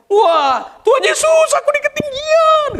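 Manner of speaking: very high, loud wailing cry; mock panicked shout. Drugged person being prayed for, mock high-pitched shriek.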